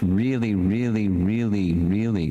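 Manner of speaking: "really" repeated four times, each one leaned on; every "really" stressed with a falling tune; piled-up emphasis. Real, not acted. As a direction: stack up "really," in a rolling, insistent run. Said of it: "that's like four reallys".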